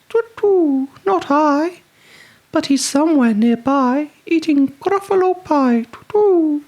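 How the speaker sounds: very high hooting owl voice. A narrator's reading. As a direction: hoot like a fussy owl. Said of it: "owl speaks".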